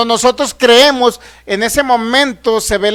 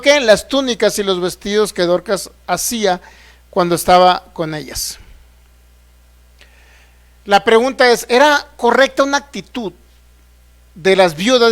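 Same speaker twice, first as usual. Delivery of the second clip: ordinary delivery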